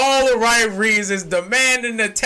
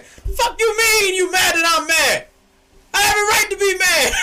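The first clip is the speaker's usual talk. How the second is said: mock outrage; 8 semitones higher than usual. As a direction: yell back at the heartbreaker. Mock quarrel with heartbreaker.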